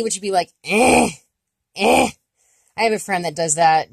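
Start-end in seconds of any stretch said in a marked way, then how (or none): 0.6-2.1 eh pushed through the nose; nasal E. Shown as a demonstration. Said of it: "nasal E".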